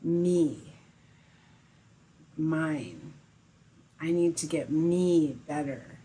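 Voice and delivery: mildly emphatic, set-apart words of wanting; grasping self-centred attitude. Acted. Meditation teacher mimicking the self-centred attitude students bring, mildly grasping. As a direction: lightly act out a needy ego. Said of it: "I see this attitude of".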